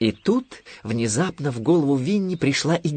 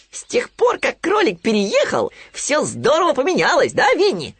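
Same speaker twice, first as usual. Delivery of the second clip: very high, loud little piglet voice; Хрюня; 4 dB louder than usual. A narrator's reading. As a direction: squeal it out like a small piglet. Поросёнок Хрюня спрашивает Винни. (тонкий громкий голос поросёнка)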